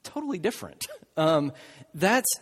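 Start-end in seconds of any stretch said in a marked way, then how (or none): 0.0-0.9 mock objection. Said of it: "you might be thinking".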